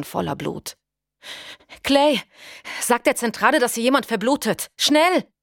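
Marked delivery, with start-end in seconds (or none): 1.8-5.3 high, loud and urgent; urgent call. A narrator's reading. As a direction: call out for help fast. Notfall, Paige ruft nach Hilfe. (drängend, aufgeregt)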